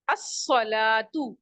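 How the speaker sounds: अस्सलातु drawn out slowly, lam silent, doubled s; slow demo word. Shown as a demonstration. Teacher shows students अस्सलातु slowly, lam silent. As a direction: say अस्सलातु slowly as as-salatu without the l. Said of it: "तो नीचे देखिए मिसालें".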